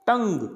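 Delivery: टंग as one syllable, U and E silent; correct pronunciation टंग. Shown as a demonstration. Teacher modelling for learners, टंग in one syllable. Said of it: "क्या बोलना है".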